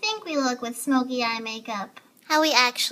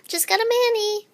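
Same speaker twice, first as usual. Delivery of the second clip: put-on girly voice; 6 semitones higher than usual. Guys imagining girl talk.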